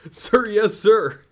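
loud, high recruit shout; barked sir yes sir. Acted. Recruit answering drill sergeant.

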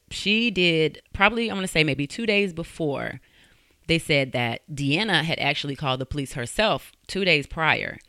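ordinary delivery

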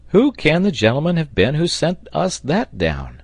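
high-pitched wondering question; baffled cry. A narrator's reading. Sailor puzzled who threw the ladder, crying out. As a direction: ask it aloud with lifted voice. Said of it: "cried the sailor".

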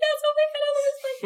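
very high, loud squealing yes; gushing excitement. Retold or imitated. Answering the playwright's email in a squealing rush. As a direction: squeal like a starstruck fan.

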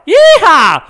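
very high, very loud cowboy yeehaw; Texan accent yeehaw; cowboy whoop. Shown as a demonstration. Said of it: "Ça, c'est l'américain".

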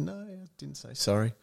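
small, high, soft mock whimper; imitating a bawling child. Uncle retells his crying niece's answer, mock bawling. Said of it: "And she's bawling".

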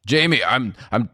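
exasperated dad. Acted. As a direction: stammer out a firm reply to your kid. Acted dad answers pleading child.

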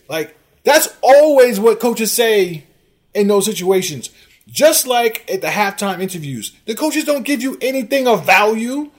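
loud, high-pitched tirade; exasperated rant. Real, not acted. Host berating a commenter about what coaches say, exasperated. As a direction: keep escalating into shouted insults.

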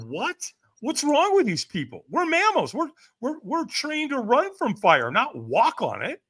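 high disbelieving rant, slowing at the end; can't believe what he sees; incredulous. Retold or imitated. His mind reeling at people walking on fire. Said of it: "my mind is going".

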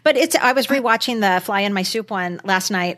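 normal talking voice

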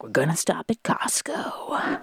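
slow, whispered dad impression; imitating dad. Daughter imitating her dad announcing a Costco stop, slow whisper. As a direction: hush it like her dad mumbling plans. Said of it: "I impersonate you guys on the pod".

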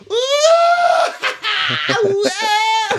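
high, loud whooping "ooh" into a wild shriek; crazed high-pitched yowl; weird screaming. Retold or imitated. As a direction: imitate a man howling nonsense from a fence. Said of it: "weird noises and screaming and shit".

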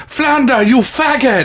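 high, loud mock Ugandan accent; laughing through a hammed-up Ugandan accent. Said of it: "do a Ugandan accent".

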